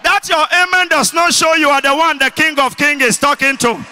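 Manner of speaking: loud, high shouted declaration; rousing preacher's shout, pressing for an amen; full-force preaching. Real, not acted. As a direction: decree it over the congregation at full voice. Declares blessing over congregation.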